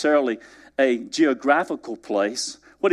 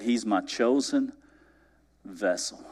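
hushed solemn; 4 semitones lower, 6 dB quieter, slower than usual. Acted. Repeated softly.

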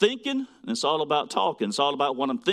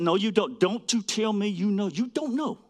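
firm correction; 5 semitones higher than usual. Retold or imitated. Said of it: "I said".

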